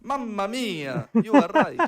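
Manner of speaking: put-on slow, high, loud Italian accent. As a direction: laugh through a hammy Italian accent. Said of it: "with an Italian accent".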